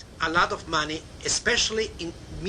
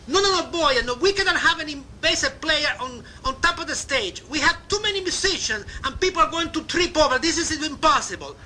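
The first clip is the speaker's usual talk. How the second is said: high, fast imitation of an Italian club owner's accent; playful Italian-accented refusal; cute Italian accent; 9 semitones higher, faster than usual. Club owner Mister Finocchio refusing Laverne's bass player, in a cute Italian accent. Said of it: "cute Italian accent".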